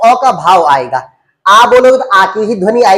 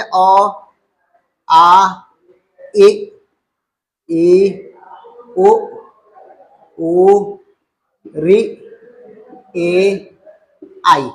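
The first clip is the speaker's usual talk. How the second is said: each vowel said alone, spaced out with long pauses; slow drilled vowel list; much slower than usual. Shown as a demonstration. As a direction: recite the vowels one by one, slowly. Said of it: "स्वर में मैंने क्या पढ़ा है पहले".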